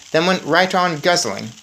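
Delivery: plain reading voice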